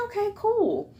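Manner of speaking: very high, sing-out acknowledgement; mock-chipper little reply; retold pleased reply. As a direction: pitch it way up, playfully agreeable. Retold reply to being given dolls.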